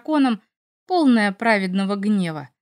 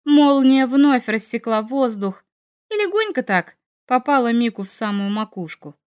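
plain reading voice

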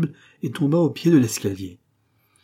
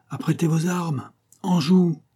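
shouted order; 8 semitones higher than usual. A narrator's reading. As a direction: give the firing order to the troops.

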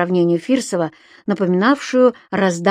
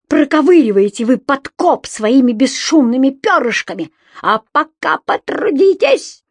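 high-pitched threatening tirade; outraged, high-voiced denunciation; shrill indignation; 5 semitones higher, 3 dB louder than usual. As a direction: rail at him in a raised, squealing voice. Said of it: "взвизгнул тот фальцетом".

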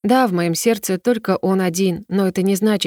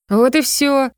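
higher-pitched, curt brush-off; snorting dismissal; 6 semitones higher, slower than usual. A narrator's reading. Drunk girl silencing the prince's objection, snorting dismissal. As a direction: cut off the argument with a scoff. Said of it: "фыркнула я".

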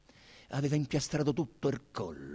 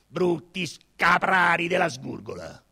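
slow angry insult; 5 semitones higher, 6 dB louder than usual. Acted. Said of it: "Ingravallo si irritò".

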